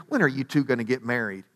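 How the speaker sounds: lightly voiced prying neighbor, low and soft; a hint of a busybody, dropped in softly; nosy neighbor. Nosy neighbor asking the woman, lightly mimicked in an undertone. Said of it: "nosy neighbor who's constantly asking".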